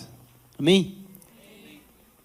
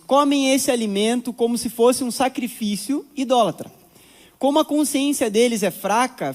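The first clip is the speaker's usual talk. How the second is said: raised, deliberate Bible reading voice; scripture reading; 5 semitones higher than usual. Acted. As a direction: read the verses to the congregation. Reads the Bible text aloud. (leitura bíblica em voz elevada)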